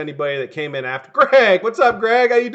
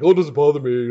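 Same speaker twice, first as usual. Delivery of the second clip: mocking lame reassurance; 5 dB louder than usual. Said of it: "such a lame fucking thing to say".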